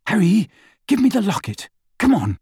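mildly impatient Hermione. A narrator's reading. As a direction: hurry him along. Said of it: "she said impatiently".

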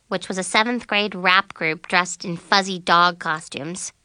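usual speaking voice

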